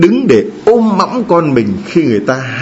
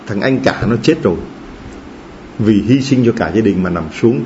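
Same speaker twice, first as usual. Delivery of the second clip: ordinary delivery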